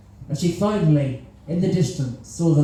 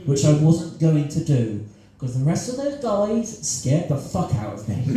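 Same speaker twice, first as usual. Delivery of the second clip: usual speaking voice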